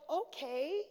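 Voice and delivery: small, hesitant okay with a questioning lift; drawn-up, unsure "okay"; reluctant okay. Retold or imitated. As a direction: go along with it reluctantly, softly. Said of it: "And I was like".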